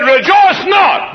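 high, loud, slow proclamation; preacher's shout. Acted. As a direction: thunder Jesus' words. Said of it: "he said".

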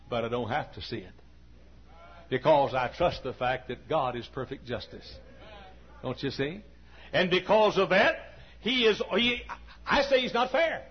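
normal talking voice

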